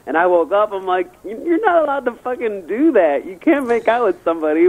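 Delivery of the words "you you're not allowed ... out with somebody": re-enacted outrage, pitched high; indignant protest. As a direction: act out scolding her, voice jumping up. Retold protest on being woken.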